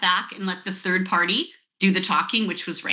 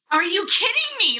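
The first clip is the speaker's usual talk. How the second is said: incredulous exclaim; 11 semitones higher, 3 dB louder than usual. Real, not acted. Disbelief at biggest career chance.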